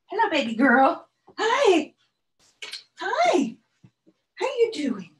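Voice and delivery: high sing-song voice to the dog; pet talk. Real, not acted. Talking to her dog.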